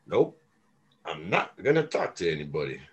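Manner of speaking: low, soft cool-guy refusal; dropped-down, aloof cool-guy voice; playing it cool. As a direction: imitate a too-cool guy shutting people out. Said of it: "play it like the cool guy".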